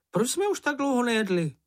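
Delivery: high, plaintive little creature; small creature voice. A narrator's reading. Hungry Čenich asks his friends about food, high creature voice. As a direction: pitch it way up like a small storybook animal.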